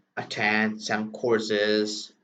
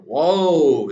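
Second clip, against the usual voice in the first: surprised whoa; 5 semitones higher, 8 dB louder than usual. Retold or imitated. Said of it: "says Rich Dad".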